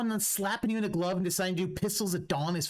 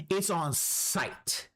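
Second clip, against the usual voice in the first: slow menacing; much slower than usual. Real, not acted.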